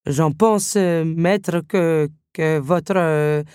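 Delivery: halting, searching for words; stammering. A narrator's reading. Candelabra groping for praise for the master, stammering. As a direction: stall with slow repeats and fillers. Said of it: "bredouilla le candélabre".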